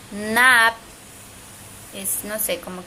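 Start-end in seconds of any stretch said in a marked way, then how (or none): 0.1-0.7 "Naap" loud and drawn out, lips closing it; final lip-closed consonant. Shown as a demonstration. Teacher repeating word for swimming, "Naap" sounded out loudly. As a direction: hold the vowel in "Naap", then shut the lips. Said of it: "un sonido con la boca".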